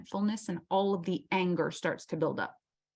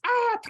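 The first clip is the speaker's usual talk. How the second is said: mock shriek; 5 dB louder than usual. Mimics an outburst.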